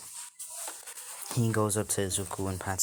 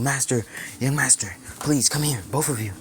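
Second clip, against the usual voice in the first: raised, urgent call for the master; urgent yelling; 4 semitones higher, 5 dB louder than usual. Acted. Servant runs in calling the master and young master, urgent yell. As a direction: call out as if running in for help. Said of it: "he runs in yelling".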